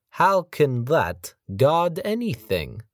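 put-on slow, high, heavy Russian accent. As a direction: lean into a thick Russian voice.

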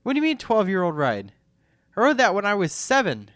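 high, loud indignant protest. Real, not acted. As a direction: protest loudly, offended. Outraged at twelve-year-old claim.

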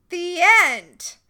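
mic-drop finish; emphatic. Real, not acted. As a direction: slam the point shut. Ending her point emphatically.

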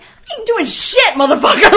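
loud, high, half-laughing outburst; outraged refusal. Retold or imitated. Stressed producer refusing crew's choreography request, outraged. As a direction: blow up in comic indignation.

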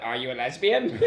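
put-on flat robotic drunk question; robot voice, deadpan. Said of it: "like a robot".

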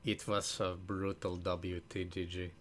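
put-on low, slow, soft German-accented voice; Werner Herzog. Podcaster trying out a back-pocket celebrity accent, attempted German accent. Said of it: "uh, a Werner Herzog".

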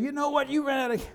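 a hint of a fed-up father lecturing; a touch of a dad scolding his son on the freeway; mock scolding. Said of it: "I could have said".

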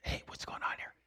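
whispered, cautious question; hushed question. Retold or imitated. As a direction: whisper it so nobody else hears. Cautious approach in frightened room.

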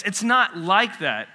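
usual speaking voice

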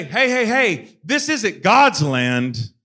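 loud and slow, waving the crowd off; a pastor shouting down a crowd for the king, loud and slow; loud warning. Retold or imitated. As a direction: call out hey hey hey to stop them, then slow down. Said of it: "He's got a pastor willing to go to the people for him to say".

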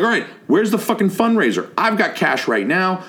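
high-pitched, demanding mock shout; retold yelling. Storyteller demanding the fundraiser from a student, excited mock yell. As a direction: press the demand, worked up and high. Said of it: "waving at this kid and yelling".

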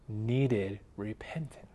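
whispered, slow and soft; hushed whisper. Real, not acted. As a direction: drop to a whisper to land it. Preacher hushes the key point.

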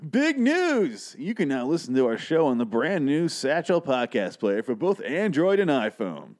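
high, hyped radio-ad voice; host reading the sponsor script, hyped announcer voice. Acted. Reads sponsor ad aloud.

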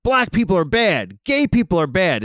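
a hint of a preachy news host; lightly voiced outraged anchor; mock Fox pundit declaring. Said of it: "Their angle is, like".

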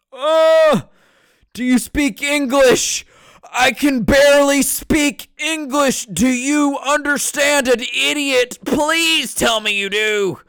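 high, loud, drawn-out wailing plea; hammed-up frantic shouting at a listener; mock desperate outburst. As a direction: yell it slowly in exaggerated exasperation. Mock outburst after brain melts over French.

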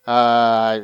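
usual speaking voice

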